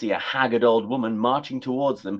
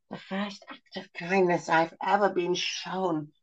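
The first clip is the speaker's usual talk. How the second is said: high, slow, soft tearful crone; moved to tears; happy sob; 8 semitones higher, 3 dB quieter, slower than usual. Acted. Crone sobbing happily at the kindness. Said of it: "she sobbed happily".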